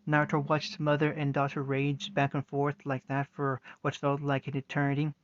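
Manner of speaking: usual speaking voice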